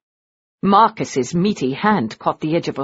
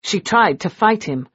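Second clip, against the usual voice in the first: plain reading voice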